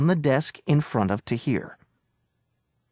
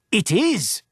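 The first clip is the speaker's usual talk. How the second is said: shouted retort; 6 semitones higher than usual. Acted. Agent insists to the owner, shouted retort. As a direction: fire it straight back. Bargaining quarrel over key.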